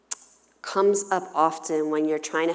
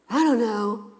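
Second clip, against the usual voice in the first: put-on kid voice; 4 semitones higher, 5 dB louder than usual. Child replies again.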